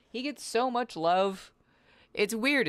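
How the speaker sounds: high, slow pet voice; cat's voice. Acted. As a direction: speak as the pampered cat. Voicing cat high slow.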